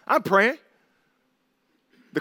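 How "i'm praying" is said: mock pray-er. Mocking people who pray wish lists.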